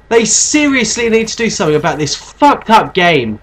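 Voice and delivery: high furious shouting; raging gamer. Real, not acted. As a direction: scream in outrage at the game. Killed by sniper, raging.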